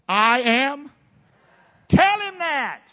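loud, high-pitched call; rallying shout. Real, not acted. Preacher rallying church against Satan, rallying shout. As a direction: shout it for all to repeat.

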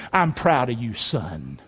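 quiet fatherly pride, pitched low; tender, proud father. Acted. God the Father to Jesus, low tender pride. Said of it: "He said this".

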